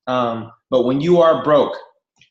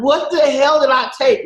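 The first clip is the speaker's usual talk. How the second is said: alarmed; 11 semitones higher, 4 dB louder than usual. Retold or imitated. Panicking after pills.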